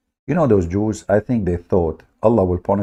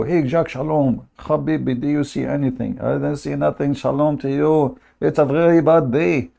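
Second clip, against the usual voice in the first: higher, mock fishermen chatting; 6 semitones higher than usual. Fishermen asking each other about fish, higher and whiny. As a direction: play the fishermen moaning about a bad day. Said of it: "they go".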